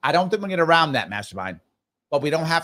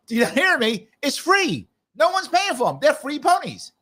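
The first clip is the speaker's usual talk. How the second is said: imitated exasperated yelling; 12 semitones higher than usual. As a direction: act out a candidate insisting, pitched way up. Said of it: "He just yelled at them".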